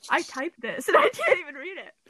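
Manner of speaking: laughing through the words; breaking up with laughter. Real, not acted. Laughing while reading notes.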